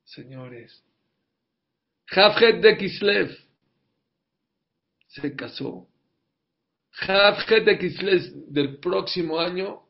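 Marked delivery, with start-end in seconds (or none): 2.1-3.4 Havhet de Kislev proclaimed loud, slow and higher; booming climax. Real, not acted. Preacher repeats date for climax. 7.0-9.8 slow, loud and higher; booming proclamation. Real, not acted. Preacher's climactic date again.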